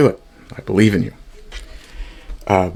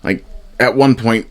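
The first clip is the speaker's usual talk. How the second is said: ordinary delivery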